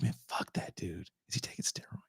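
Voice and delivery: whispered jealous grumble. Retold or imitated. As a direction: hiss it under your breath at the screen. Jealous muttering at the TV.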